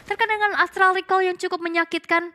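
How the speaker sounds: rising hype on the big play; esports caster. Acted. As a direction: call the action, build to the kill. Casts the match play-by-play. (komentator esports yang bersemangat)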